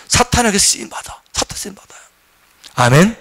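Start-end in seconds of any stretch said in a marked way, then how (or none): 1.3-2.0 whispered warning; preacher's stage whisper. Acted. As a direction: drop to a hushed whisper for effect.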